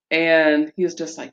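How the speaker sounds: ordinary delivery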